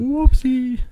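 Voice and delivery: very high silly voice; squeaky whoopsie. Pretend. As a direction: squeak it out in a cartoon voice. Mock reaction to wrong god.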